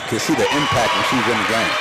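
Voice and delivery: ordinary delivery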